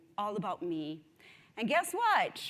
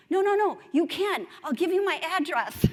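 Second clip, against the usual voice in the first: higher, louder pleading no no no; eager insisting kid; 9 semitones higher, 10 dB louder than usual. Retold or imitated. A boy begs his seatmate to visit, loud and eager. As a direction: insist like a keen kid who won't take no.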